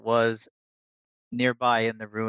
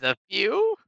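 mocking; 9 semitones higher than usual. Ghost mocks the drinking.

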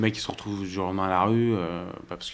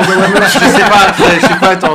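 laughing; 10 semitones higher, 15 dB louder than usual. Real, not acted. Laughing after frog imitation.

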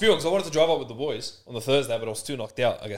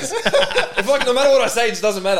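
laughing; 9 semitones higher, 8 dB louder than usual. Real, not acted. Laughing at being ignored.

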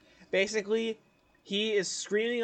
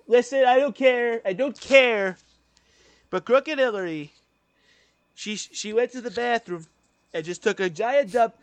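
louder, higher mock Trump bluster; Trump impression; 5 semitones higher, 6 dB louder than usual. Doing Trump for a friend, loud confident bluster. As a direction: swagger through it like Trump boasting. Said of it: "He's like".

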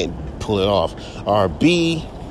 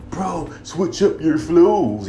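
higher, slow mocking voice; mocking a fan; 6 semitones higher, slower than usual. Rapper mocking fans who nag him to switch flows. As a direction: parrot the nagging fan, drawn out. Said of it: "people sit there and tell me".

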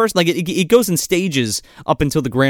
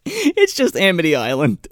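high burst of laughter spilling into words; real laughter while correcting a friend; laughing; 13 semitones higher than usual. Real, not acted. Laughing at the misnaming.

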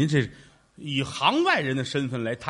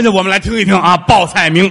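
louder announcer call; loud announcement; 10 dB louder than usual. Acted. 向观众大声宣布报菜名. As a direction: call it out to the house.

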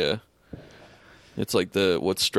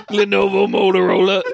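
words squeezed out through laughter; laughing; 10 semitones higher, 6 dB louder than usual. Real, not acted. Friend repeating the joke name, laughing.

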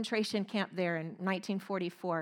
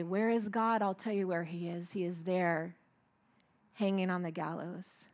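inner voice; 4 semitones lower, 7 dB quieter than usual. Retold or imitated. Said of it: "I heard a voice within myself say".

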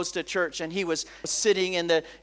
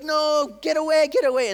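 high, louder frantic order; urgent friend; 6 semitones higher, 4 dB louder than usual. Retold or imitated. Friend pulling the girl away from the preacher, urgent and high. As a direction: imitate a frantic friend, pitched right up.

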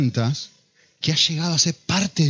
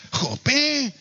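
put-on high, whiny Spanish child's "¡Jope!"; acento de España; 9 semitones higher than usual. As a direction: attempt a Spain accent, like a complaining kid. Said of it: "estoy tratando de imitar el acento". (acento español imitado, quejoso y agudo)

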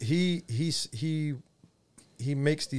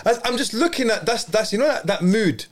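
high, laughing through the words; can barely get it out for laughing; giggling while pointing at the meme; 10 semitones higher, 5 dB louder than usual. Real, not acted.

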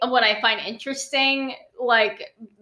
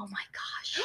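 soft whispered gasp; hushed recognition; 15 dB quieter than usual. Retold or imitated. A stranger suddenly recognizes her, whispered. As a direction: breathe it out in a whisper.

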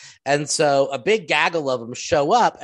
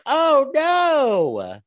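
mock dismay; 11 semitones higher, 5 dB louder than usual. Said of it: "And they're like".

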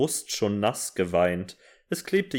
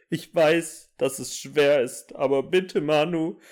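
mock sobbing; 7 semitones higher than usual. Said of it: "schluchzte ich".

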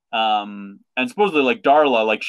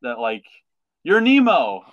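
hammed-up joyful recognition. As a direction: burst out as it clicks. Dory remembering, voiced.

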